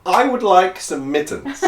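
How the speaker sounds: high, loud, slow imitation of Dan's announcing; grand mock-formal request; mock announcement. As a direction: announce it like a proud proclamation. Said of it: "I love that".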